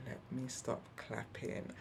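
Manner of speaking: whispered aside to herself; a hushed note to self. Retold or imitated. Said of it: "I was like".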